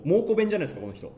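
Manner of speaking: ordinary delivery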